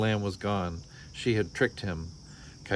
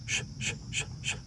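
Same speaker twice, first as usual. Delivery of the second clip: swimming whoosh sound; 8 dB quieter, faster than usual. Acted. Coyote swimming sound effect.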